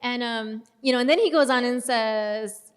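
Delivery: ordinary delivery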